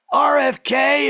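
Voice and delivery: RFK blurted out high and loud; loud name. Real, not acted. Comedian announcing the health secretary, loud and high. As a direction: call out the name for laughs.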